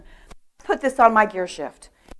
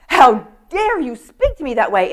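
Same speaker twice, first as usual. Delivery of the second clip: higher, louder acted outrage; mock indignation; 5 semitones higher, 6 dB louder than usual. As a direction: play an affronted woman telling someone off. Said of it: "she was so mad".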